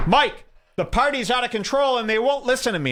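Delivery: high-pitched frantic complaint; panicked plea. Retold or imitated. Panicked guy knocks on his door.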